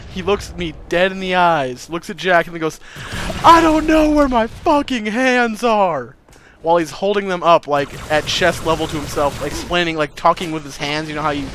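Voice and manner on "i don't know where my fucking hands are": high, loud, drawn-out wail; slow, high retold cry of alarm; panicked freak-out. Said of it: "like, wigging out".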